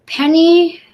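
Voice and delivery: mother crying out. Acted. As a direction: call her back firmly across the yard. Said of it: "my mother cries out".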